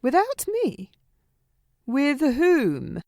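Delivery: taken aback and put out; indignant surprise. Acted. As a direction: react as if slighted at being left out. Learns he is coming without her.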